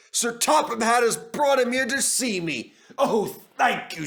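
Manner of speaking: high, grateful rush; bubbling over with thanks; overjoyed. A narrator's reading. Gordon thanking Sir Topham Hatt, high and overjoyed.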